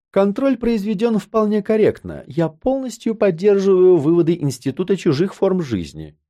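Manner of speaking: dry. A narrator's reading. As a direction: state it drily, like an official finding. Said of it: "сухо произнесла Анна". (сухо и официально, более высоким голосом)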